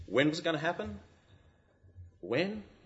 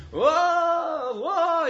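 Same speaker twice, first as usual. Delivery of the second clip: high, drawn-out mock wail; 13 semitones higher, 7 dB louder than usual. Preacher imitating the crowd wailing for Jesus, high mock wail. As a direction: wail like a grieving crowd, hammed up. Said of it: "they cry in these, these things".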